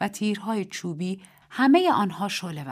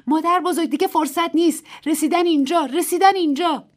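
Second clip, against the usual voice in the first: high, loud panicked scream; 11 semitones higher, 9 dB louder than usual. A narrator's reading. Little Pig's wife waking grandmother, high loud scream. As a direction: shriek the alarm at grandmother. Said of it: "جیغ کشید".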